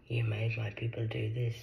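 soft whispered blame; whispered accusation. Real, not acted. Blaming earth people in a soft whisper.

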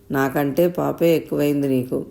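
usual speaking voice

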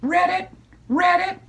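croaky frog saying read it; frog croak. Acted. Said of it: "he said".

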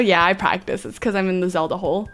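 usual speaking voice

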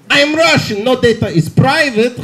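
hammed-up Russian doctor accent; comic Russian accent, starting high. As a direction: boast in a mock Russian accent. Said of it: "the weird Russian doctor I had".

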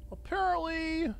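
high, slow drawn-out mock word; mock high sing-song. Streamer mocking own mistake, high drawn-out sarcasm.

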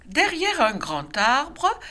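plain reading voice

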